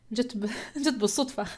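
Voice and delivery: quick aside dissolving into laughter; amused, rapid laughing remark. Real, not acted. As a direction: break into laughter while talking. Amused aside while teaching.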